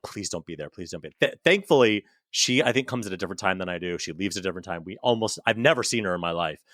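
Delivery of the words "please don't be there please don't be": hushed plea. Retold or imitated. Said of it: "Every day I'm like".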